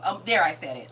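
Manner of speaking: normal talking voice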